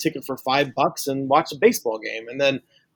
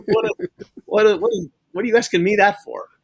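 high, louder mock-incredulous question with a laugh; amused self-quote, higher and baffled; laughing disbelief; 8 semitones higher, 4 dB louder than usual. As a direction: laugh and stammer it out, pitched up in disbelief. Acted past thought, laughing disbelief.

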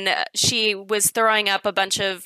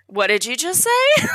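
higher-pitched mock-incredulous question; stunned disbelief; 5 semitones higher than usual. Friend overhearing the drag queen's compliment, stunned disbelief. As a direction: imitate a stunned friend butting in.

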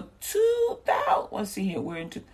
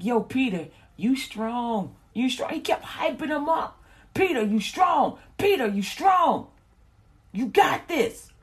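hyping up; 5 semitones higher than usual. Retold or imitated. He kept hyping him up.

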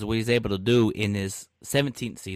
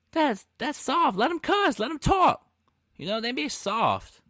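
high-pitched incredulous complaint; exasperated protest; 10 semitones higher than usual. Real, not acted. Exasperated at censored trash talk.

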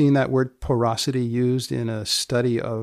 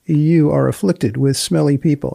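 louder, punchy announcer read; podcast outro; 5 dB louder than usual. Acted. Host signing off the Improbable Research podcast, loud announcer voice. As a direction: sell the sign-off like a radio host.

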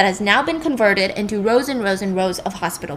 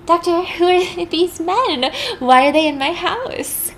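high, slow, bewildered woman's voice; frightened Rachel asking the doctor who these men are; Rachel's voice; 8 semitones higher, slower than usual. Retold or imitated. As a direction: play the confused patient, pitched up high. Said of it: "And Rachel says".

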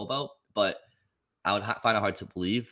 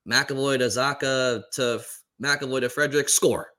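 monotone play-by-play; 4 semitones higher, 5 dB louder than usual. Retold or imitated. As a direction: imitate a joyless broadcaster calling a goal. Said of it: "like, really monotone".

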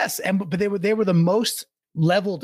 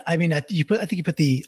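ordinary talk, nothing marked

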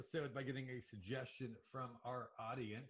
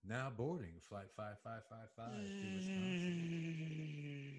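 slow, drawn-out gate announcement; over the intercom; 2 semitones higher, slower than usual. Acted. Repeated PA announcement, slower.

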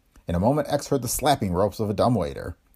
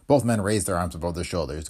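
ordinary delivery

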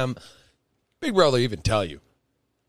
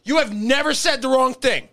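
sarcastic retort; 12 semitones higher, 4 dB louder than usual. Retold or imitated. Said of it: "And I'm like".

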